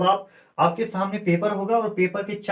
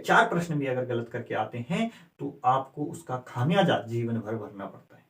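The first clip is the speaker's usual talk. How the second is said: गंभीर चेतावनी; 6 semitones lower, 4 dB quieter than usual. Real, not acted.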